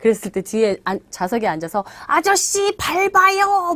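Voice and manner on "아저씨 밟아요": high, drawn-out call to the driver; acted shout. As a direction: yell urgently from the back seat, hammed up. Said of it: "뭐 이렇게 소리치잖아요".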